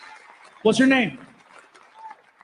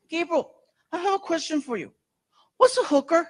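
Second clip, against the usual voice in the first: put-on kid's voice; 8 semitones higher than usual. Son voiced, much higher.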